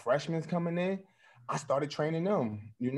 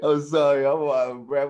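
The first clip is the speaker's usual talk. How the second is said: loud laughing apology, breathless; 8 dB louder than usual. Real, not acted.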